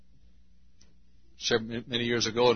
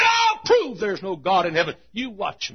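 loud, high scornful shout; defiant atheist; 9 semitones higher, 4 dB louder than usual. Acted. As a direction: sneer at heaven. Atheist challenging God.

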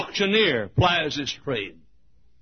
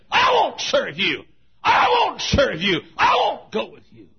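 high, loud, repeated refusal; girl shouting her refusal; defiant shout; 8 semitones higher, 5 dB louder than usual. Acted. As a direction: throw it back at him each time.

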